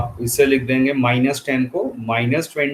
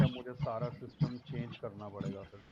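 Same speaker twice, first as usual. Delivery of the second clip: barely audible; 21 dB quieter, much faster than usual. Real, not acted. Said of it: "लो आ रही है".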